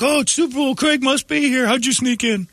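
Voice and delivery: very high gushing fan voice; high squealing admirer; starstruck fan. Retold or imitated. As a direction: gush at Craig like a giddy fan. Fans greeting comedian Craig.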